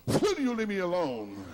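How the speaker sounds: high outburst that slides down; mock exasperated cry. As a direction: cry it out like a fed-up rejection. Acting out a girl rejecting him.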